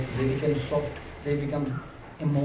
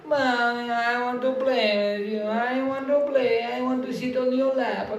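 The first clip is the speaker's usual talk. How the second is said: put-on high, slow whiny little child begging; baby voice; 7 semitones higher, slower than usual. Toddler begging busy mother to play, high and whiny. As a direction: plead like a toddler wanting mom. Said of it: "And baby goes".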